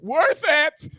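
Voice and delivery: high, put-on yell; triumphant shout. Man told never again, high triumphant shout. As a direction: crow it high, unrepentant. Triumphant retort after being scolded.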